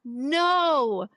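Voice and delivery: long, drawn-out cringing no; mock pleading dismay. Viewer watching an awkward scene, in mock dismay. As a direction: draw each word out as if begging it to stop.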